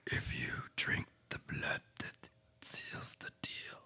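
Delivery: eerie ancient whisper of a dark power; gravelly whisper. Acted. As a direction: hush it out like a creeping voice from the shadows. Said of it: "things got a little quiet and a little gravelly".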